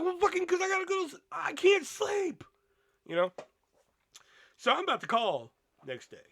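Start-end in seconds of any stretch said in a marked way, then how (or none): 0.0-2.4 exasperated outburst. Retold or imitated. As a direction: blurt it out, fed up and sleepless. Angry retort to noisy guests.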